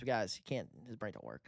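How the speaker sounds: ordinary talk, nothing marked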